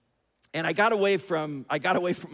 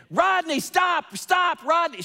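raised, high-pitched mother's yell; 10 semitones higher, 4 dB louder, slower than usual. Retold or imitated. Mom yelling at her young son on the pier, yelling. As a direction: call out loud and high, stretching the words. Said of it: "she starts yelling at me".

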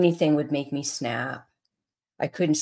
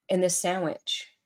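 normal talking voice

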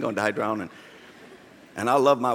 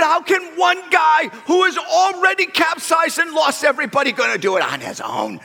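yelled protest; 6 semitones higher than usual. Retold or imitated. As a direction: yell back the protest. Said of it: "we're yelling back and forth".

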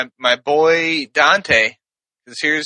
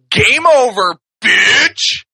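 raised, punchy taunting call; mock game announcer; 5 semitones higher, 4 dB louder, much slower than usual. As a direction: announce the knockout with relish. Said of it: "then know what it says".

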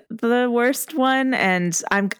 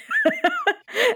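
burst of laughter. Real, not acted. Laughing at own joke.

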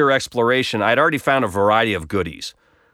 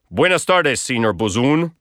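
Spanish greeting. Retold or imitated. Hotel staff greeting in Spanish.

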